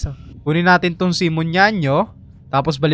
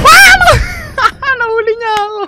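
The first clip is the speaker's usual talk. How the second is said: high, loud shriek then laugh; startled scream; 11 dB louder, much slower than usual. Real, not acted. Player caught by the enemy, screaming then laughing. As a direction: scream as you get caught.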